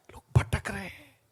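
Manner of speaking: hushed, sad repeat; whispered. Real, not acted. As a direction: drop to a whisper on लोग भटक रहे हैं. (फुसफुसाकर दोहराना)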